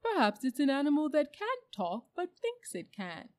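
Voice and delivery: high, loud, eager donkey voice; bright donkey. A narrator's reading. Donkey offers the animals a theory, brightly. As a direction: pipe up brightly, pitched well up. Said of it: "said the donkey brightly".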